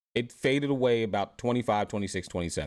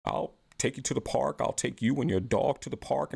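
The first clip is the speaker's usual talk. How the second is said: higher, eager, goofy man's voice; eager idiot suitor; 5 semitones higher than usual. Retold or imitated. Host mocks a man trying to meet her standards, higher goofy voice. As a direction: play a keen fool who wants to please her. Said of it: "He'll be like".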